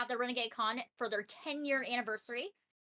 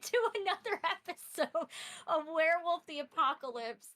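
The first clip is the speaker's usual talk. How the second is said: storyteller voice; 5 semitones higher than usual. Acted. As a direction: welcome them like a fireside storyteller. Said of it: "automatically put on the storyteller voice".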